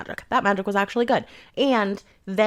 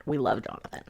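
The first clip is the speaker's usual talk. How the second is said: whispered, softer, tender aside; fond whisper; 7 semitones lower, 6 dB quieter than usual. Real, not acted. Reviewer whispers her love for the little cat. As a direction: hush it like a shared secret. Gushing over the cat character.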